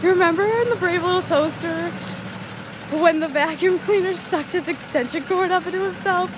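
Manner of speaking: high and loud, gushing with excitement; loud, high gushing recollection; excited. Retold or imitated. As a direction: share a beloved memory eagerly. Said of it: "he's like".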